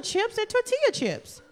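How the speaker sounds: high, emphatic insistence on tortilla; hammering the word tortilla up high; insistent. Retold or imitated. Insisting to boyfriend on the walk, high and emphatic. Arguing about chips with boyfriend.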